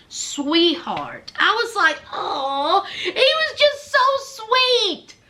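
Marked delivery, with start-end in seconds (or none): 2.1-5.2 high, drawn-out "aww" gush. Retold or imitated. Viewer melting over the sweet stepdad, high gushing. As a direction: coo it slowly, voice climbing high.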